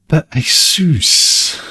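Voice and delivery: high, very slow "Asus" as EE-soos; mock American Asus. Vlogger mocks the US pronunciation of Asus, slow and high. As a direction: drag out the American EE-soos in disbelief. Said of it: "the U S pronunciation of Asus is bonkers".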